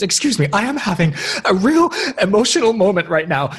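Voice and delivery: high, loud, put-on indignant voice; mock dramatic outburst. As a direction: play the wounded drama queen. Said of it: "She goes".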